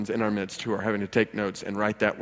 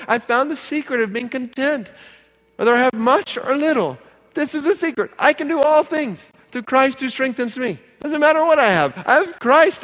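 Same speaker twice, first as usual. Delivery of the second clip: high-pitched, fervent pulpit delivery; impassioned preaching; 10 dB louder than usual. Real, not acted. Pastor proclaims contentment in Christ to church, impassioned preaching. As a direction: proclaim it with rising fervour.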